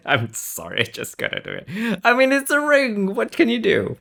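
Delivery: ordinary delivery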